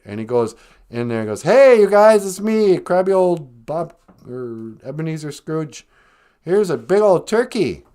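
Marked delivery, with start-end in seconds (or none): 1.4-7.8 loud, high hammed-up hello; big comic announcement, high-pitched; exuberant Scrooge greeting. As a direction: burst in on the family full of cheer.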